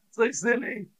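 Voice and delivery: high-pitched, real amused squeal; laughing. Real, not acted. Teacher tickled by a learner's silly sheep pun, laughing. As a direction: squeak it out through the giggles. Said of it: "That made me laugh".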